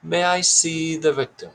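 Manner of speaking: high, mock-British polite inquiry; weird British accent. A narrator's reading. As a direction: ask it like a British detective. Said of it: "still in that weird accent".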